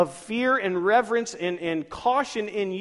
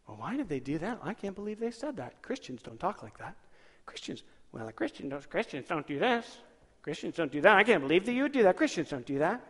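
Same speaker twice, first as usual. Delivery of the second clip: hushed mock-judgmental whine; softly sniping in disbelief; snarky, nasally; 4 dB quieter than usual. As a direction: mimic a holier-than-thou younger self, nasally. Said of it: "I didn't sound nasally like that".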